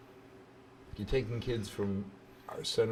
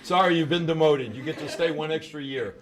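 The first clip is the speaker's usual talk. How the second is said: chuckling through the joke, louder; laughing tease; 4 semitones higher, 11 dB louder than usual. Real, not acted. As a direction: tease her while laughing. Host teasing student, laughing.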